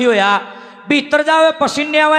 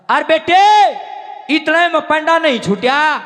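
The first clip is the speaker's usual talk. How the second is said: preaching shout; 5 semitones higher than usual. Real, not acted. Preacher drives point home.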